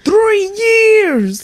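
loud, high gasp of disbelief; shocked. Real, not acted. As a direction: blurt it out in shock. Shocked reaction to the post.

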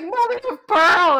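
loud, very high, drawn-out cry; big mock-startled outburst; retold exclamation. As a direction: blurt it out high and slow. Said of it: "I was like".

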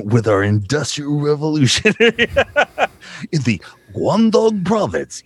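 slow, grand voiceover cracking up; laughing mock-epic narration. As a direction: intone it grandly through laughter.

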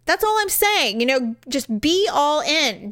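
ordinary delivery

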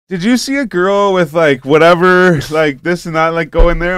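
high, loud mock patron voice; imitating pestering patrons. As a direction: pitch it way up, a hammed-up questioner. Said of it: "come up to me and go".

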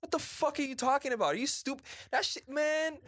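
high-pitched, incredulous rant; indignant outburst. Real, not acted. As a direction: burst out high in exasperated disbelief. Indignant outburst at the mocked claim.

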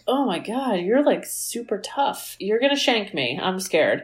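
quiet, deadpan pretend fright; unimpressed, hushed teasing; mock fear. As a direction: feign being scared, softly and sarcastically. Mocking the supposedly tough kid.

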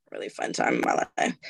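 breathy whispered repeat, no voice at all; a soft whispered aside to herself; whispered echo. Shown as a demonstration. Whispered echo of own line.

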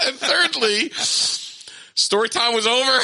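high, laugh-choked punchline; cracking up. Real, not acted. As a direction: barely get it out through laughter.

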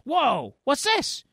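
very high, wondering exclamation; amazed discovery. Acted. Storyteller as Mikey finding his present, high-pitched amazement. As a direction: act out wide-eyed surprise, pitch soaring.

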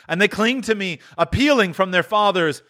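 high, solemn warning voice; solemn spirit. Acted. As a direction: warn with mounting force. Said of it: "cried the spirit".

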